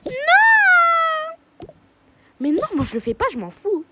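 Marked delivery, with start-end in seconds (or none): 0.0-1.7 high wailing no; whiny cry of refusal. Acted. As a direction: protest like a sulky child. Kid refusing to cook.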